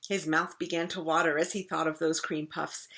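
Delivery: plain reading voice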